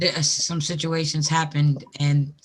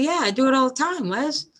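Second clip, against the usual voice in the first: higher, quicker smug Megan voice; imitating Megan; 9 semitones higher than usual. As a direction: play Megan bragging casually. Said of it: "Megan said".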